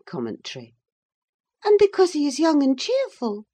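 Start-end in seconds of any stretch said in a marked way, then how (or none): 1.6-3.5 put-on high, insistent young lady; high-pitched defensive answer; Catherine. A narrator's reading. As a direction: justify yourself in a high, raised voice.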